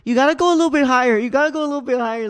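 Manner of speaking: fast, loud and high; imitating an overeager coach; mock eager coaching. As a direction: rattle off the instruction, hammed-up. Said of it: "he goes".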